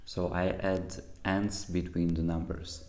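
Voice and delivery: normal talking voice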